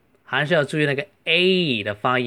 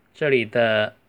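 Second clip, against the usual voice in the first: usual speaking voice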